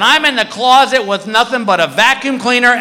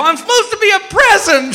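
high, whining-pitched comic protest; defensive husband; 12 semitones higher than usual. Retold or imitated. Husband telling wife his costume idea, defensive. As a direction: explain yourself in mock desperation.

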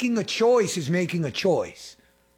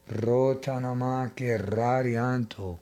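low, slow murmured tongues; speaking in tongues; 8 semitones lower, 3 dB quieter, much slower than usual. Real, not acted. Preacher prays in tongues to the congregation, low and slow. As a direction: pray it out low and drawn.

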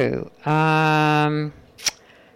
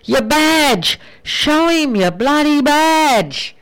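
high, urgent call across the field; yelling at top of voice; 8 semitones higher, slower than usual. Acted. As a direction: bellow it to someone far away, slowly. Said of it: "yelled at the top of my voice".

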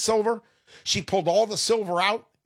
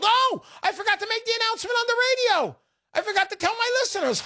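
high, fast mock dismay; much higher, fast, playful shame; mock self-scolding; faster than usual. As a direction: squeak it out like a guilty kid. Host scolds himself in a mock voice.

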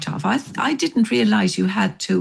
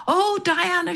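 mock shout; 10 semitones higher than usual. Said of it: "she shouted".

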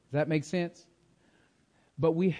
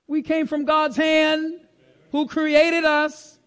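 preaching refrain; 7 semitones higher than usual. Real, not acted. Preacher telling congregation God's hand made us, rising preaching refrain. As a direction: hold it high, then swell into a slow proclamation.

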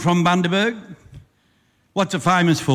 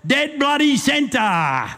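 slow, drawn-out higher cry; triumphant cry; 8 semitones higher, much slower than usual. Acted. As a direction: proclaim it like a marksman showing off. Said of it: "raised his rifle to the bus and cried".

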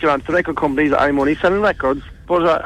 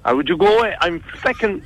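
normal talking voice

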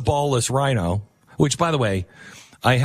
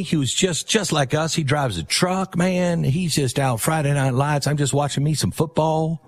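lightly voiced Texan bro, higher; mock good ol' boy; 6 semitones higher than usual. Mocking Jimmy as a truck-driving Texan, higher and lightly drawled. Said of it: "for good old Jimmy".

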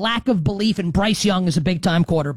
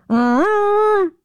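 higher, louder hype shout of the name; hype call; 11 semitones higher, 4 dB louder than usual. Acted. Host hyping new quarterback for listeners, excited higher shout. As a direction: announce him like a star entering. Hyping Vikings roster names.

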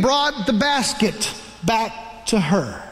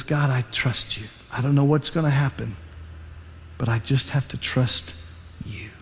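soft, low trusting prayer; a mother's hushed surrender to God; 9 semitones lower, 6 dB quieter than usual. Retold or imitated. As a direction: pray it quietly, letting go. Mother prays over her baby.

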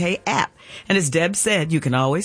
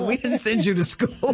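higher, laughing mock-scold of a parent; 8 semitones higher than usual. Host imitates her family scolding a would-be singer, laughing. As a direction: play the disapproving family, half-laughing.

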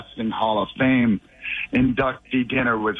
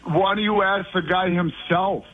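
normal talking voice

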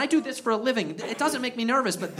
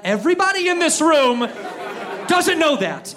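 louder, higher exclaim; loud punchline; 7 semitones higher, 4 dB louder than usual. Real, not acted. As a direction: shout it to the crowd. Comic shouts at crowd.

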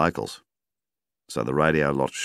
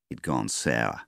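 ordinary delivery, nothing marked

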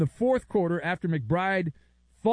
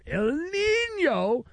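hammed-up exclamation; 13 semitones higher, much slower than usual. Announcer-style exclamation.

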